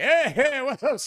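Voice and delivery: hey, hey called high and loud; high loud mock greeting. Workers driving off, calling to the hurt neighbor: high loud hey. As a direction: mimic their cheerful shout over. Men calling cheerfully to him.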